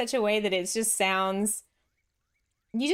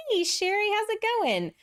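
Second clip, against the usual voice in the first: much higher, sing-song hello; bright greeting; 13 semitones higher, 3 dB louder than usual. Real, not acted. Streamer greeting Sherry in chat with a cheerful call. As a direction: call out happily to a new viewer.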